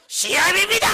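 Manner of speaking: high, loud alarmed cry; frightened yell; panicked shout. Retold or imitated. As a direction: shout it in terror. Mother cries out at pastor.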